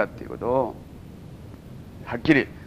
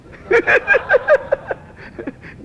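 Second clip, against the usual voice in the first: high laugh; 3 dB louder than usual. Real, not acted. Laughing at his own joke.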